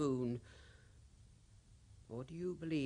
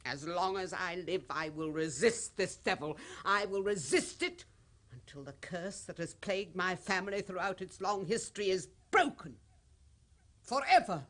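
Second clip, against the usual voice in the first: grows higher and louder to a shout; defiant vow building to a cry; 5 semitones higher, 5 dB louder than usual. Acted. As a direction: rise from resolve to a fierce cry. Widow vows to resist the curse.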